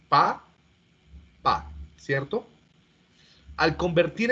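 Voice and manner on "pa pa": "Pa" and "pa" spaced apart, slow; syllable by syllable. Shown as a demonstration. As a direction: sound out each "pa" slowly with pauses. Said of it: "Que serían en los fonemas". ("Pa, pa" silabeado lentamente)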